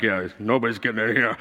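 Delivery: lazy smug soldier brag; cocky guard. Retold or imitated. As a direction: Roman guard lounging in armor, a hint of swagger.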